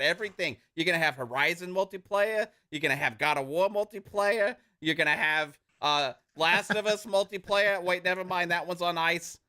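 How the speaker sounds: put-on hard-sell patter in an Australian-Jersey accent; mock salesman. Salesman selling multiplayer to fans in an Australian-Jersey accent. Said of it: "I'm trying to be a salesman".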